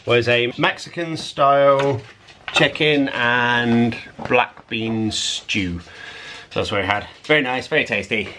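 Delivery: ordinary talk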